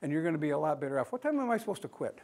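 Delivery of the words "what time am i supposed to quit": ordinary delivery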